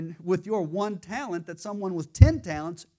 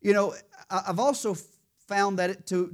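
usual speaking voice

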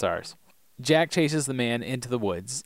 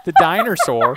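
louder, higher silly shout of The dinosaur; loud goofy; 6 semitones higher, 9 dB louder than usual. Shown as a demonstration. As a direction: belt out The dinosaur like a joke. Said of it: "repeated take".

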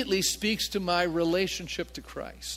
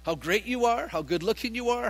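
ordinary delivery

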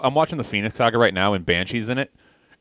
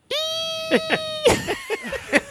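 very high, thin sustained squeal; mock scream; 4 dB quieter than usual. Imitating Banshee's scream from the cartoon, long high squeal. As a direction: imitate a silly screech without volume. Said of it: "But then he screams".

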